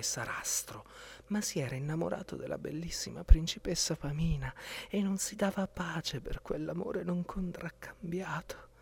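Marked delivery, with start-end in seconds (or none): none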